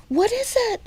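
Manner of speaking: put-on high, scared boy's cry; panicked high squeal; frightened shriek. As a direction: shriek it like a terrified kid. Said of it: "He said".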